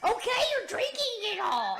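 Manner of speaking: high, loud mock child's whine; complaining kid. Kids sharing mom's soda, mock indignant child.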